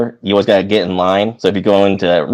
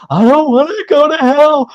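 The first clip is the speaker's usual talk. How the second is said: put-on high wailing little kid voice, much higher; crying child; 3 dB louder than usual. To his parents at one in the morning, mock crying child. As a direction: whine it like a scared seven-year-old sobbing.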